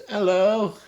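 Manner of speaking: hammed-up old woman greeting; old lady's hello. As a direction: chirp a hello like an elderly neighbour. Elderly neighbour Elsie greets him.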